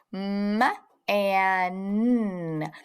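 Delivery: "M and n" as separate, held sounds. Shown as a demonstration. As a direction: hum out each sound in "M and n". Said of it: "each sound you hear in the word".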